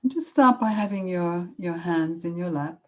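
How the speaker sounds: leading a room through a self-compassion break; guided meditation. Acted. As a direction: ease them into stillness, unhurried.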